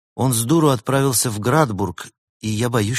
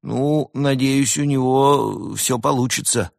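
slow, higher-pitched, drawn-out wish; hesitant well-wishing; 6 semitones higher, slower than usual. A narrator's reading. Koala politely wishes the traveller luck, hesitantly. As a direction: stretch it out, unsure what to say.

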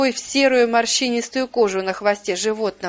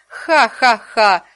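slow, loud witch's cackle; evil cackle; 5 dB louder, much slower than usual. Acted. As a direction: cackle with spiteful glee. Ghost witch cackles gloatingly. (злорадный ведьминский хохот)

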